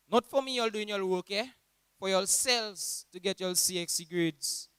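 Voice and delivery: high, loud mock-scolding teacher; exclaiming teacher. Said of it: "Miss Duba would exclaim".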